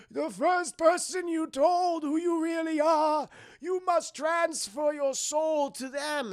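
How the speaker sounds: high, slow, strained theatrical voice; agonised confession. Retold or imitated. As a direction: act out a tortured man forced to confess. Tortured John gives up secret.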